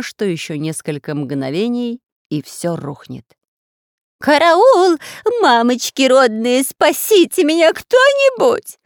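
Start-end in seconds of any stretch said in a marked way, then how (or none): 4.2-8.8 shrill, very high panicked shrieking; terrified scream. Acted. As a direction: scream for help in terror. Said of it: "закричал нечеловеческим голосом".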